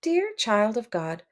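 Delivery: ordinary delivery, nothing marked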